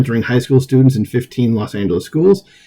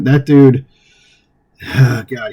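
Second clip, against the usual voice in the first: normal talking voice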